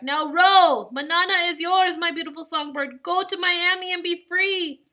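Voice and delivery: high, loud farewell shout; yelling back. A narrator's reading. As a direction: send them off across the water. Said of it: "Lito yelled back".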